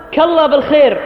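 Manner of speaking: كالله بالخير with massaak cut down to k; clipped greeting. Retold or imitated. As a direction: swallow the start, begin straight on كالله. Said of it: "اختصارا". (كالله بالخير مختصرة بحذف أولها)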